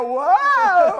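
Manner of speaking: high, loud startled whoa; a playful alarmed whoa; mock whoa. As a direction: yelp whoa as you run off. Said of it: "I go".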